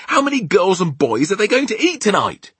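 high, loud cry of alarm; distressed cry. A narrator's reading. Sophie asks the giant about tonight's victims, crying out. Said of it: "Sophie cried".

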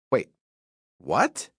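plain reading voice

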